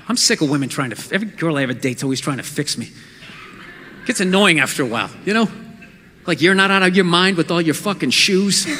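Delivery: put-on low, fast grumbling boyfriend voice; sulky boyfriend. Acts a sulky angry boyfriend.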